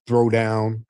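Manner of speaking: usual speaking voice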